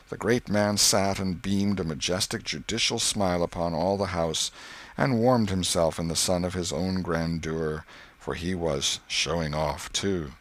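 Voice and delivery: usual speaking voice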